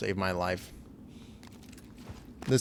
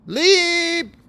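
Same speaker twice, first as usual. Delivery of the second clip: a sustained high-pitched jump cry; high, loud call; 9 dB louder than usual. Real, not acted. Player launching a jump in the game, high loud call. Calls out while jumping in game.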